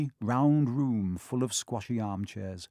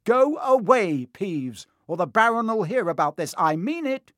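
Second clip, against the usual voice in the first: high, stern, exasperated command; barked order; 8 semitones higher, 5 dB louder than usual. A narrator's reading. Percy ordering Peeves away, barked. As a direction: bark it out, pitched well up. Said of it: "barked Percy".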